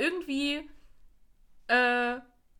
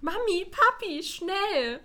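higher, slow call for parents; 7 semitones higher, much slower than usual. Retold or imitated. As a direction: call urgently for mum and dad. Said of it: "Bibi ruft". (Bibi ruft aufgeregt nach Mama und Papa)

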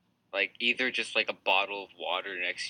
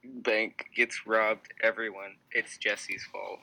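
slow mock announcement; slower than usual.

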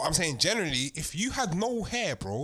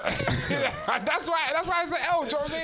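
loud laughing outburst; laughing shout; 9 semitones higher, 4 dB louder than usual. Real, not acted. Group bursts out laughing.

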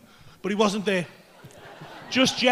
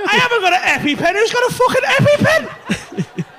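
incredulous panic; 9 semitones higher, 4 dB louder than usual. Retold or imitated. Panicked questioning in the retold emergency.

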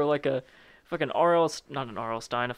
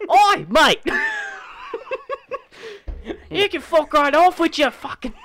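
loud, high mock Aussie yell; hammed-up angry Australian demon; Australian accent; 8 dB louder than usual. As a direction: shout back in a half-assed Aussie accent. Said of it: "why is there an Australian".

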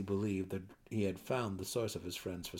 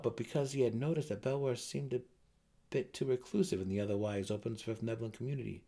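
plain reading voice

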